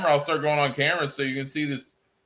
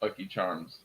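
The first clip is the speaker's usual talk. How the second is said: ordinary talk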